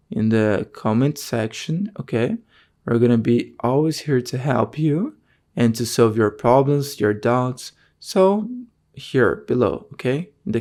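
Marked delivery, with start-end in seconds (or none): none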